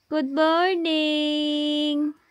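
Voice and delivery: high, very slow sing-song good morning; baby talk greeting. Acted. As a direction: coo it to the baby, stretching every sound. Mother greeting baby Jack.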